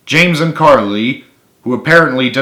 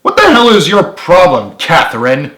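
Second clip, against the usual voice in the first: higher, louder angry retort; losing patience; 6 semitones higher, 7 dB louder than usual. A narrator's reading. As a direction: fire it back, patience gone. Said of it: "James retorted, losing his patience".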